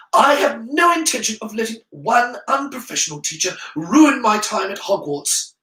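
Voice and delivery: high, firm schoolboy voice; young pupil standing up to his teacher; deadly calm defiance. A narrator's reading. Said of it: "Harry said with deadly calm".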